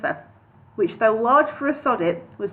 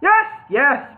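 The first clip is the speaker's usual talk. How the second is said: indulgent boom; 5 semitones higher, 5 dB louder than usual. Acted. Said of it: "boomed the wizard indulgently".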